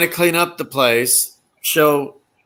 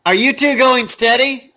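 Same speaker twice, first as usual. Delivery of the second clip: high, put-on teasing question; Larry impression; 9 semitones higher, 4 dB louder than usual. Larry teasing Moe and Curly as they hug, imitated high. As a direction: tease in a raised voice. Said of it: "Larry gets on him again".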